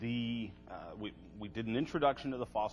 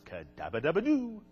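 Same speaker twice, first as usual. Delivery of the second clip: higher-pitched cartoon cheer riff; Flintstone-style catchphrase; 4 semitones higher than usual. Lecturer joking about the Flintstone fossil, playful cartoon cry. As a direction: play the name as a cartoon catchphrase. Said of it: "Flintstone fossil".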